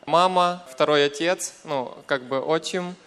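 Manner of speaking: ordinary talk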